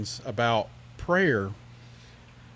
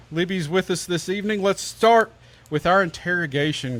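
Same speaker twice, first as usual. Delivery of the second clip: usual speaking voice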